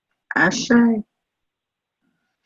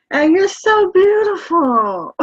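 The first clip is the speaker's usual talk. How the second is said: higher, louder swell of adoring praise; gushing praise; 8 semitones higher, 9 dB louder than usual. Real, not acted. Host gushes to the speaker in a higher, louder burst of praise. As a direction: pour out the compliment with excitement.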